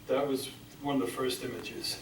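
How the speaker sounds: ordinary delivery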